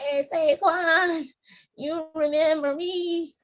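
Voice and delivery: put-on high, slow old-lady voice; high creaky elder calling out to him. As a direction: play a real old fan greeting him. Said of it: "real old by then".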